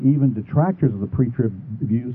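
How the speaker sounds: usual speaking voice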